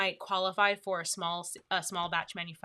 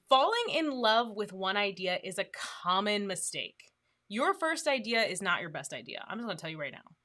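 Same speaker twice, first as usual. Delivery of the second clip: ordinary talk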